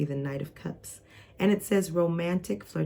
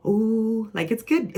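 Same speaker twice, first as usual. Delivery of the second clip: excited ooh; 5 semitones higher, 4 dB louder, slower than usual. Pretend. Acting out an excited feeling.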